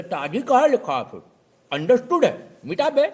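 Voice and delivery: know-it-all yelling to erase tazi; mock shout. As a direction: build to a scornful shout. Customer ordering word erased.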